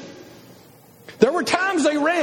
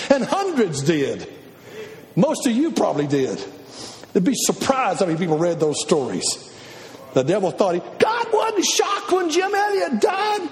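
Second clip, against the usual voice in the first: normal talking voice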